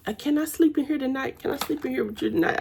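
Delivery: imitating a shy little son, fast; little kid asking. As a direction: ask like a small boy who wants to stay up. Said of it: "He'd be like".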